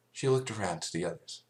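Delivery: ordinary delivery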